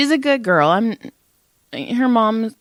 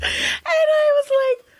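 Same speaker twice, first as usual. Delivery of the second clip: laugh-crying; slower than usual. Real, not acted. Laughing till crying mid-story.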